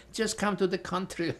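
put-on mild Polish Russian newcomer accent. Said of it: "I spoke with a Polish Russian accent".

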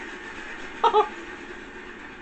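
high, airy giggling; breathless laugh. Real, not acted. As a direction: laugh without voice. Laugh after win.

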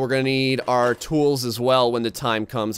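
usual speaking voice

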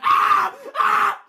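ordinary talk, nothing marked

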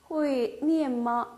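each syllable spelled out slowly; slow model reading. Shown as a demonstration. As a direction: read it slowly for learners. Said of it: "의문 형태". (천천히 또박또박 읽는 예문)

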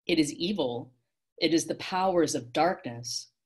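ordinary delivery

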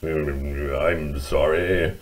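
low, soft, slow mole mumble; mumbling mole. Acted. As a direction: mumble drowsily into yourself as an apologetic mole. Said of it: "mumbled Mole".

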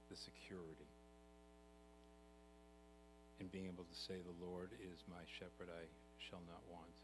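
soft, hushed prayer voice; gentle opening prayer to the congregation. Acted. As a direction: pray quietly and reverently. Opening prayer before sermon.

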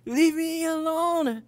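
high, bold model take; coaching the line. Acted. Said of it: "I say, looking at him".

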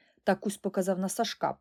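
usual speaking voice

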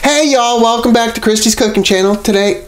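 bright, high YouTube host greeting; cheery cooking show opener; host intro voice. Acted.

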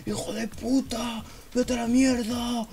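high, slow screamed insults; raging fighter. Retold or imitated. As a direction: howl them while punching. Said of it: "le empieza a pegar de puñetazos". (Alex insultando a gritos mientras pega)

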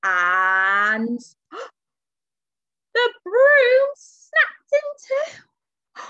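slow, gasping build to a high cry; dramatic storytelling alarm. A narrator's reading. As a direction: draw out the disaster, gasping and climbing high.

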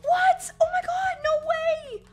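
high, loud squeal of disbelief; gasp, then squeal it high and loud; real shocked delight. Real, not acted. Fan finding a surprise while unboxing, shocked delight.

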